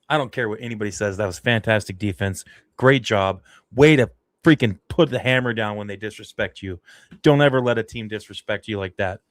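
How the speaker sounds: loud, fired-up rant; worked-up, emphatic and quick to start; emotional outburst. Real, not acted. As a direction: burst out in fierce praise for the defense. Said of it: "I got a little emotional there".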